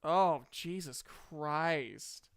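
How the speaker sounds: ordinary talk, nothing marked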